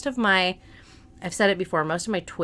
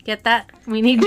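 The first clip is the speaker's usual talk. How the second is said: usual speaking voice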